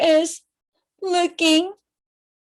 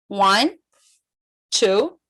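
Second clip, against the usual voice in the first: usual speaking voice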